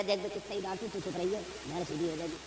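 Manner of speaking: mock soothing of a bullied man; hushed coaxing. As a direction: hush the poor man into silence. Imitating the headman hushing a poor man.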